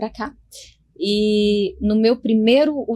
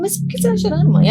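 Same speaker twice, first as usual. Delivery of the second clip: doctor puzzled; 12 semitones higher, 6 dB louder, much faster than usual. Retold or imitated. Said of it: "Aí ela falou".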